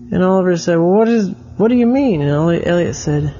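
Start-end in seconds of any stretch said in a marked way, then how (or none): 0.8-2.2 put-on kid's voice pitched well up, puzzled; higher puzzled child. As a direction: ask it like a confused little boy. Oliver puzzled question.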